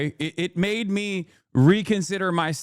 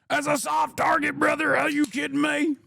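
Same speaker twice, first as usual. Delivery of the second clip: much higher put-on voice, shouting brother; mock gun-nut outrage; 10 semitones higher than usual. Streamer still voicing indignant gun fans, high mock yell. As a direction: squeal the complaint like an offended gun fan.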